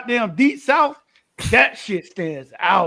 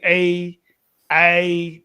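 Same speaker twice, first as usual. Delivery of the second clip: put-on Canadian accent; 3 semitones lower than usual. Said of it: "So they will say".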